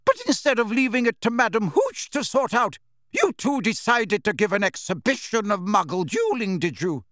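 high, furious bellow; outraged teacher's tirade. A narrator's reading. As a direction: bellow a scolding at the pupils. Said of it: "bellowed Professor McGonagall".

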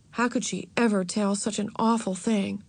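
plain reading voice

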